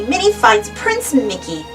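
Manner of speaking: ordinary delivery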